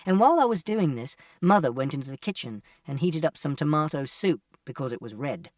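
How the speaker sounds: ordinary delivery, nothing marked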